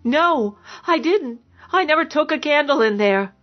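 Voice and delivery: high, pleading protest; frightened denial. A narrator's reading. Accused woman denying the candle to her lover, frightened.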